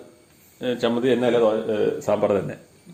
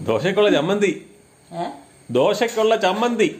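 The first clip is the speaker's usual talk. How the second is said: raised for a hard-of-hearing listener; 7 semitones higher than usual. Real, not acted. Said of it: "ഏ?".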